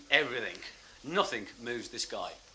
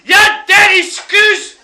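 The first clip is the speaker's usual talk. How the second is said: high, screaming Scottish rant; wild Scotsman; 18 dB louder than usual. Retold or imitated. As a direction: rave at full volume in garbled Scottish. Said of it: "this Scotsman goes wild".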